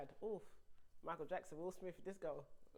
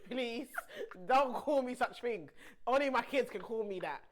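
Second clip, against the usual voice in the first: mock outrage; 4 semitones higher, 11 dB louder than usual. Mock outrage at being called mummy.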